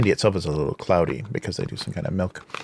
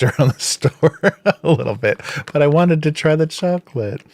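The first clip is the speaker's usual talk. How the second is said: laughing; 8 semitones higher, 4 dB louder than usual. Real, not acted. Laughing about a battered bag.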